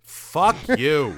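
loud, drawn-out exasperation; exasperated shout. Real, not acted. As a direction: hurl it out, fed up. Disgust at the book's ending.

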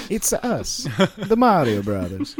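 high, hammed-up Italian plumber voice; Luigi. Co-host joining in as Luigi cheering Mario, cartoon Italian accent. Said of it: "And Luigi's like".

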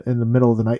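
ordinary talk, nothing marked

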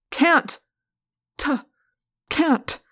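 high, halting, broken up; distressed refusal. A narrator's reading. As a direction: falter on each "can't". Cornered narrator repeats his refusal.

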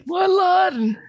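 put-on high, loud old man yell. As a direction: shout it like a deaf old man. GM voices old clerk.

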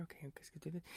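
whispered to himself, psyching up; inner whisper. Retold or imitated. Groom rehearsing proposal in his head, whispered pep talk. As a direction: whisper it under your breath like a silent pep talk.

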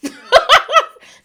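loud squealing laugh; high burst of laughter. Real, not acted. Laughing at her own tears.

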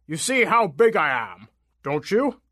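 slow, put-on giant voice; puffed-up giant apple voice; boastful giant. A narrator's reading. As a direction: show off as a big slow monster.

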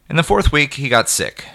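ordinary delivery, nothing marked

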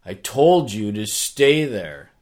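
loud, slow mock reprimand; acted exasperated father, hitting the words hard; scolding dad. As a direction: drag out a stern parental telling-off. Dad scolds injured daughter.

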